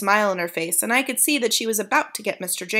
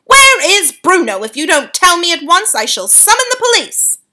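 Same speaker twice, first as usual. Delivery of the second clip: loud, high, threatening; father threatening to call the police; angry demand; 9 semitones higher, 9 dB louder than usual. A narrator's reading.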